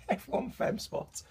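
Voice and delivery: giggling through the words; breathless giggle while reading the label; laughing. Real, not acted. Laughing at rude-sounding plant name.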